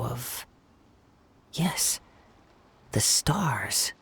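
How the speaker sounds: hushed reveal. Acted. Mystic trailing into a whisper.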